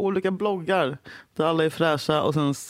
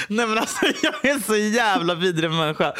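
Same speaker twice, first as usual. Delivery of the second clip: high burst of laughter, then words through it; laughing; 5 semitones higher than usual. Real, not acted. Telling Julia she is awful, laughing through it. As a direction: giggle through the self-blame.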